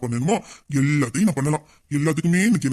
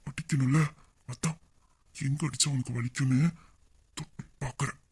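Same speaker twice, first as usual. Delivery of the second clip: much softer, near-whispered tease; intimate murmur; 3 semitones lower, 9 dB quieter than usual. Acted. Husband murmurs to his wife while holding her, very soft. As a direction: murmur it right against her.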